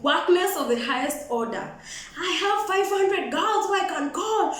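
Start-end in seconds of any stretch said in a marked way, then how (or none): 2.2-4.5 high-pitched, put-on bragging voice; mocking boast. Drunk guy boasting of girls, mocking imitation. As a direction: mimic a braggart in a higher voice.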